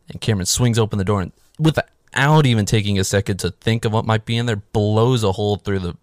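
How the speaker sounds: normal talking voice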